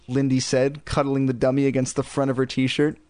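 usual speaking voice

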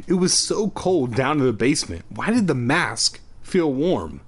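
ordinary delivery

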